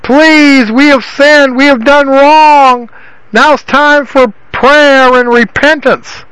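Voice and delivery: high, drawn-out mock plea; begging repentance. Fathers who had suffered beg Yahweh, imitated high pleading. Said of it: "begged and said".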